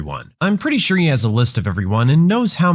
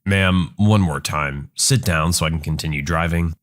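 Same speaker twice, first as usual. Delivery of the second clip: calm bus driver; 8 semitones lower than usual. Acted. Driver's final warning.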